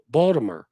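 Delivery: "Baltimore" reduced to Bawl-duh-mer; local pronunciation of Baltimore. Shown as a demonstration. Correcting the standard form, "Baltimore" as local Bawl-duh-mer. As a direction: end "Baltimore" on a loose -mer. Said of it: "They pronounce it".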